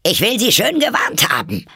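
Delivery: high, loud furious queen; shouting. A narrator's reading. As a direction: scream a royal threat. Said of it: "schrie die Königin". (wütend geschrien)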